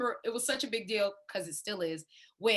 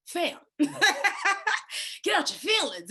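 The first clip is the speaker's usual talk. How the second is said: friend's pep talk; 7 semitones higher, 4 dB louder than usual. Retold or imitated. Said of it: "He'd be like".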